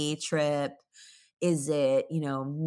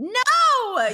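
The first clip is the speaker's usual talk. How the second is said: high loud laughing no; playful outraged objection; laughing denial; protest; 11 dB louder than usual. Real, not acted. Objecting to friend's claim.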